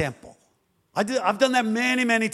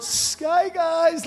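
much higher excited whoop; gleeful cry; 10 semitones higher than usual. Retold or imitated. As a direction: whoop it like skiing. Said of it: "Peter skiing behind boat".

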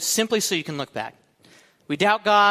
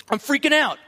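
hammed-up panic, high and louder; mock freaking out; 11 semitones higher, 6 dB louder than usual. Preacher acting his pre-move self, mock panic. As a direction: squeal it like a fake meltdown. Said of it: "It's like".

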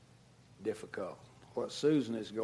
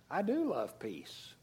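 usual speaking voice

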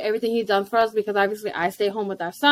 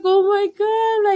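slow high oh my God; pitched-up slow delighted gasp of words; touched surprise; 9 semitones higher, slower than usual. Retold or imitated. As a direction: melt at the sight of the boy. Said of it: "And I was like".

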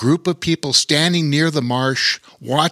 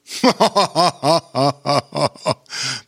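pirate laugh; 4 semitones higher than usual. A narrator's reading. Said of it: "bellowed Pete the Pirate".

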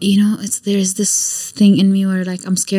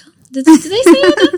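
high, loud startled self-quote; mock surprise; 10 semitones higher, 6 dB louder than usual. Startled at herself for saying something. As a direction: squeak it out as if caught off guard. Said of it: "I'm like".

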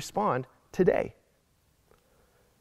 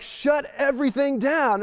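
higher, slow mock shut-it-down demand; 8 semitones higher than usual. Pastor mimics someone demanding lockdown, higher and slow. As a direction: drag it out like a fed-up complainer. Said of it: "Some people are like".

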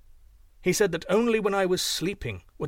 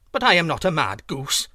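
louder indignant protest; angry goose; 7 dB louder than usual. A narrator's reading. Goose Chang objects to being called mad, louder angry protest. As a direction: bristle at the insult. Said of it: "hissed the goose angrily".

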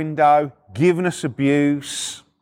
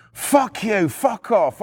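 higher, sharp swearing; retold angry abuse; 5 semitones higher, much slower than usual. Neighbours giving repo men abuse out the window, angry.

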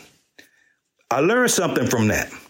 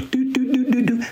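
phone ringing; 9 semitones higher than usual. Retold or imitated.

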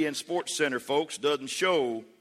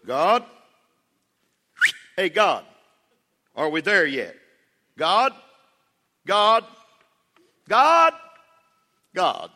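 loud calls, climbing higher each time; calling out; 3 semitones higher, 7 dB louder, slower than usual. Acted. Impatient child calling out to God, louder and louder. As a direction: holler for someone who isn't answering.

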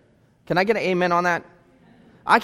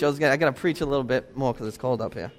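lower, softer and fast, offhand; quick quiet aside; 7 semitones lower, 8 dB quieter, faster than usual. Real, not acted. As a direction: toss it off under the breath. Quick aside about cold stage.

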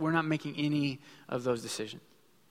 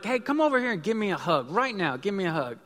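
higher, coaxing call for a hug; coaxing dad; 6 semitones higher, 4 dB louder than usual. Retold or imitated. Dad calls his daughter over for a hug, coaxing. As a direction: coax the kids over for a hug. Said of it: "if I say to them".

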